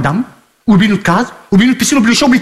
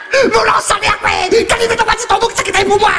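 high shouting rant; 11 semitones higher than usual. Real, not acted. Shouting at Sylvie.

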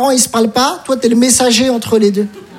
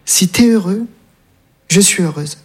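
tender mother; 4 semitones lower, slower than usual. Retold or imitated. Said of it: "Elle m'a dit".

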